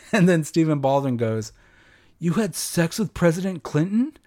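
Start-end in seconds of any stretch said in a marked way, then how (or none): 2.2-4.2 high-pitched mock disbelief, rising at the end; astonished retelling of a punchline; incredulous question. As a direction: quote it like a stunned movie buddy. Acted movie quote, shocked question.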